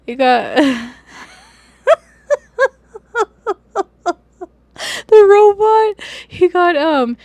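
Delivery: can't get the words out for laughing; real giggling bursts mid-story. Real, not acted. Laughing while telling about robot.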